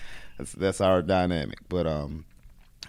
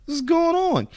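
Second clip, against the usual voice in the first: much higher, loud disbelieving protest; incredulous at teammate; 5 dB louder, slower than usual. Retold or imitated. Wrestler protesting to his teammate, high and incredulous. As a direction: squeak out betrayal at your own teammate. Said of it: "And I'm like".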